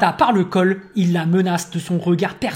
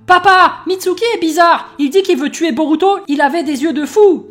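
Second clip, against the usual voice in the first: put-on high, louder alarmed girl; exclaiming girl; 9 semitones higher, 6 dB louder than usual. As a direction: blurt it out to dad. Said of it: "Sarada qui s'exclame".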